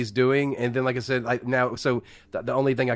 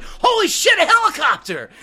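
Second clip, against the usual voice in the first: very high, loud, excited holler; mock amazed shout; 5 dB louder than usual. Acting out spotting a helicopter, hammed-up high shout. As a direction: shout it as an over-the-top gawker. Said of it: "you're like".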